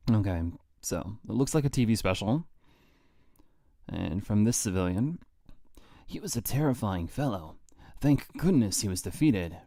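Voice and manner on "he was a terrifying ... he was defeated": hushed whisper. Acted. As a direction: read it in a whisper. Reading civilian quotes in whisper.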